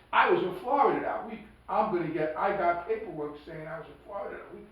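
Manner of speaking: imitating a co-defendant's eager alibi; insistent alibi. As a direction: push the alibi hard, a bit worked up.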